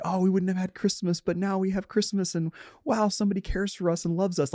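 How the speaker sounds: high, lightly voiced overjoyed child; a hint of a thrilled kid imagining Christmas; imagined grateful kids. Picturing the kids they hoped to help, a light grateful child voice. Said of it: "you can imagine the kids who are like".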